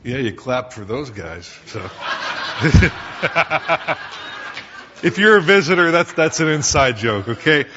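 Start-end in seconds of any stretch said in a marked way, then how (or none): 1.9-5.0 real airy laugh at the congregation; a burst of unvoiced laughter; laughing along at an inside joke; breathy laugh. Real, not acted. 5.0-7.7 laughing explanation. Real, not acted. Explaining inside joke, laughing.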